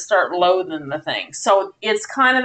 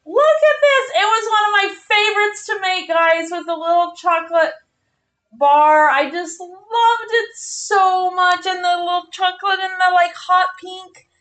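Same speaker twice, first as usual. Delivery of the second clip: high, gushing excitement over a favorite; 10 semitones higher than usual. Real, not acted. Streamer showing viewers her favorite chocolate bar, gushing high. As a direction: squeal with delight over the little chocolate.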